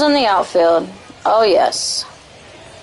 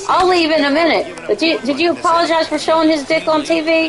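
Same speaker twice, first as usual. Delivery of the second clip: higher, insistent mock accusation; Little Mama; 5 semitones higher than usual. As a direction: pester for an apology in a raised voice. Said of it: "That last little bit was Little Mama".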